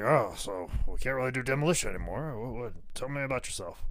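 low, quiet, hesitant boss; subdued Vince. Acted. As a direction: feel out the new gimmick quietly. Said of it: "Vince's like".

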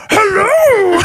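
very high goofy puppet cry; big dim puppet. Acted. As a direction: greet like a big daft Muppet.

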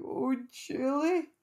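high, slow, pitiful wail; mock weepy cry for a friend; tearful plea. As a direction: whimper her name like a weepy new mum. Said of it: "I was like".

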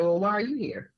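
a smart-mouthed jab, half joking; sassy comeback. Retold or imitated. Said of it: "not trying to be smart, but just my mouth".

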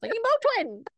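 high mock little-kid whine; whining toddler. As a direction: imitate a small child whining for medicine. Mother repeats child's wail.

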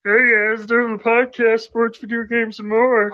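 Bark. Acted. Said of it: "and Bark on the show?".

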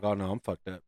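quietly retold sinking realization; subdued dismay. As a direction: say it low, to yourself. Retold dismayed inner thought.

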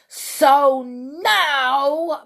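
high, loud witch bark. Acted. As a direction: bark it to get attention. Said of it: "barked the Grand High Witch".

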